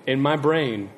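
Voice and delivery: usual speaking voice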